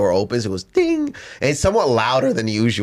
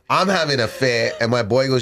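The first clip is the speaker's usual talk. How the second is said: usual speaking voice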